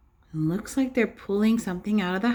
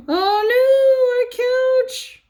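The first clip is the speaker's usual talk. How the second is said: shocked dismay; 6 dB louder, much slower than usual. Acted. Voicing the shocked mommy.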